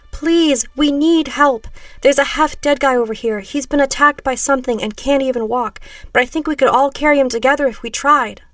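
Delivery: high, loud pleading; urgent plea. A narrator's reading. Woman to strangers about a wounded man, urgent pleading. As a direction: beg them for help.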